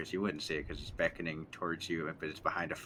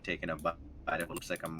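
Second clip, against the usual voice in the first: normal talking voice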